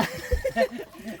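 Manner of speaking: high laugh. Real, not acted. Laughs about the fish.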